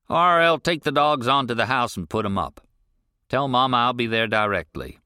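calling to son. Acted. Rancher ordering his son to take the dogs home, loud. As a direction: send the boy off with the dogs.

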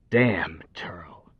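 unvoiced hissed curse; whispered curse. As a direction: curse it under your breath. Curse under his breath.

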